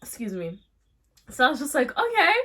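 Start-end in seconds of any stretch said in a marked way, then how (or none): 2.0-2.3 much higher and louder, falling; taken aback; startled okay. Retold or imitated. Narrator reacting to the separate phone, much higher startled okay.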